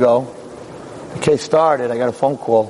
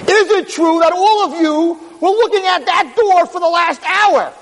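high, slow, ringing challenge; thundering accusatory question; 13 semitones higher, slower than usual. Acted. Lawyer springing his trap on the jury, high and accusing. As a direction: hammer the jury with a drawn-out question.

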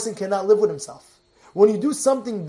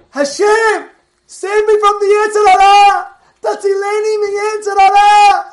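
loud, high desperate cry to God; screaming prayer; 11 semitones higher, 11 dB louder than usual. Retold or imitated. Old rabbi crying out to Hashem, screaming. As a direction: scream the plea with a crying edge. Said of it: "And he's screaming".

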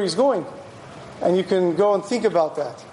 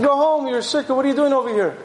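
higher-pitched retold rebuke; scolding; 5 semitones higher than usual. Eli sends the supposed shikar home, scolding. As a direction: scold her in mock indignation.

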